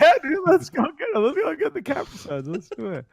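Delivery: teasing friend voice; imitating Flint. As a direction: start high and eager, drop away.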